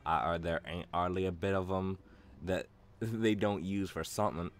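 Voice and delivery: mock Cockney accent for a game character. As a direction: play it as a chatty Cockney market trader, in a Cockney accent. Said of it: "That's my Cockney".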